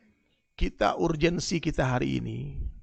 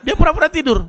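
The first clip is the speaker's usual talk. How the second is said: higher, louder exclamation; loud punchline; 11 semitones higher, 11 dB louder than usual. Acted. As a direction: hammer home the joke. Punchline about feigned sleep.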